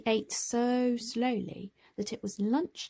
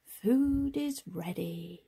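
slow Tom; slower than usual. Acted. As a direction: take your time, calm and kind.

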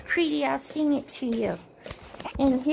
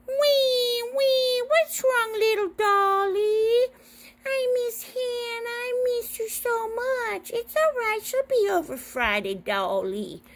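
squeaky high comforting; high toy voice; 9 semitones higher than usual. Pretend.

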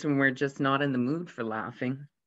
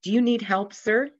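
mock yell; 7 semitones higher than usual. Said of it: "the captain yelled".

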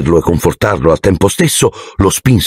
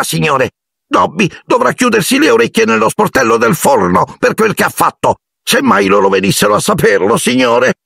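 much higher elf voice, fumbling for words; a servant too anxious to begin; fretful house-elf. A narrator's reading. House-elf trying to warn Harry, much higher and flustered. Dobby panics.

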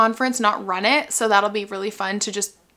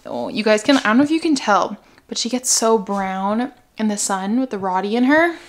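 ordinary talk, nothing marked

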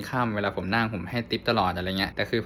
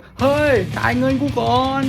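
high, loud, slowed mock yell; imitating an angry shout; 14 semitones higher, 5 dB louder than usual. Taxi driver yelling at the passenger to pay, high loud mock shout. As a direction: bellow it like a furious old driver. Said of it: "ลุงแม่งตะโกนแบบ".

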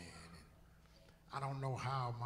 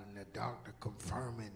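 usual speaking voice

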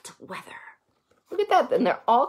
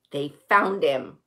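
plain reading voice

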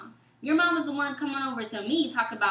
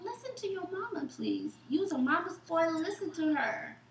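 higher teasing mock voice, quieter; mocking taunt; 6 semitones higher, 5 dB quieter than usual. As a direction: needle him as a mama's boy. Mocking the mama's boy ex.

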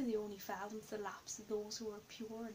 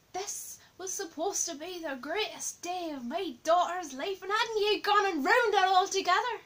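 angry raised shout, building louder and higher; 6 semitones higher, 11 dB louder than usual. Acted. Father shouting at the Brits on his daughter's wedding day, outraged. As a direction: bellow it in outrage, swelling to the end. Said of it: "he was outside shouting at the Brits".

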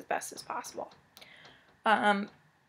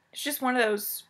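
usual speaking voice

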